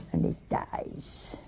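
hushed whisper on the punchline; whispered reveal. Acted. Preacher telling the congregation the man died, whispered. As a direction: drop to a whisper for the ending. Dramatic end of deathbed story.

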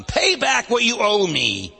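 loud, slow, demanding the money back; a servant throttles his debtor, loud, slow demand. Acted. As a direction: bark it out like a creditor choking his debtor. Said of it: "he demanded".

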